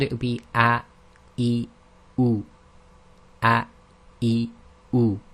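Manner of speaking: a, e, u each started with a throat catch; hamza with vowels. Shown as a demonstration. Teacher drilling hamza vowels for students, short and abrupt. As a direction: drill the vowels off a glottal stop. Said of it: "And then with, with the vowel sound".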